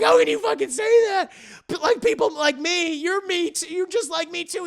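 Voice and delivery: high-pitched, loud outburst easing off in volume; indignant protest. Retold or imitated. Chuck rejected by his lover, indignant protest. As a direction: protest in a squeaky, wounded pitch.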